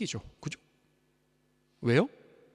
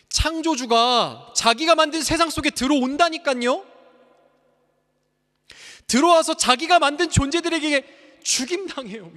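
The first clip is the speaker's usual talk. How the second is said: much higher and louder proclamation; impassioned preaching; 13 semitones higher, 9 dB louder than usual. Acted. Pastor proclaiming the incarnation, impassioned. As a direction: cry out in amazement.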